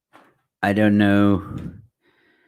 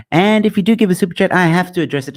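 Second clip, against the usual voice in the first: higher, faster, louder eager plug; excited pitch; 11 semitones higher, 5 dB louder, faster than usual. Real, not acted. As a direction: hype up the super chat ask. Pleading for super chats.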